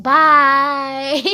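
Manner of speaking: big, drawn-out mock farewell breaking into laughter; loud parting shot with a laugh; loud dismissive bye. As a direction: belt out a goodbye as you storm off. Retold walking off from argument.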